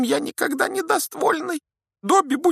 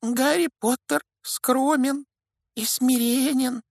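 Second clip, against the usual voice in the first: very high, slow respectful squeak; reverent elf; 6 dB louder, slower than usual. A narrator's reading. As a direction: speak it in awe. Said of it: "почтительно произнёс Добби".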